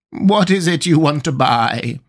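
lightly voiced old sheep, drawn out; slow question with a hint of sheep; sheep shopkeeper. A narrator's reading. Old sheep shopkeeper addresses Alice.

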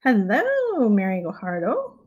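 ordinary talk

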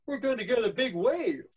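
high, loud warning; acted rising panic; alarmed boy. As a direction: cry out the danger as a boy. Boy warns of coming wave.

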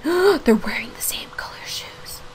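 gasp, then whisper in surprise; whispered shock, slowly. Real, not acted.

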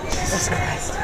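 winded, unvoiced; breathless whisper. Real, not acted. Breathless after ride.

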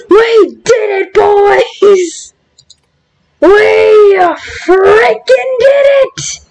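high, drawn-out victory cry; triumphant cheer. Real, not acted. Gamer celebrating with the boys, high triumphant cheer.

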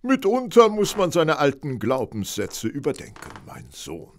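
a hint of a solemn clergyman; lightly put-on preacher tone, ending on mein Sohn; mock pastor. Holmes in cassock teasing Watson, mild mock pastor voice. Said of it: "An Ihnen ist ja ein echter Pfarrer verloren gegangen".

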